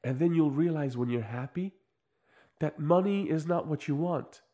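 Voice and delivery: put-on self-help expert voice; measured mock-guru explanation; mock MBA expert. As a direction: imitate an earnest expert, pausing between phrases. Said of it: "then the N B A comes on".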